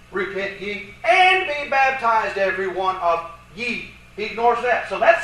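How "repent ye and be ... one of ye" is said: slow quote with "and" hit loud and long; stress on and. Shown as a demonstration. Lecturer restoring what his opponent ignores, heavy stress on "and". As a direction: punch "and", then keep the rest measured. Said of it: "he ignores the "and".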